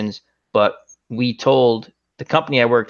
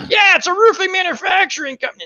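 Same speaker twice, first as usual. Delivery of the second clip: much higher sarcastic voice; mocking coworkers. Coworkers joking about the number, high mocking tone. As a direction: tease like coworkers in on the joke. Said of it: "and they're like".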